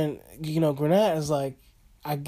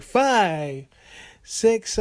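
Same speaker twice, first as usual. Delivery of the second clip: referee's count; 5 semitones higher than usual. Retold or imitated. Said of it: "the referee just counting".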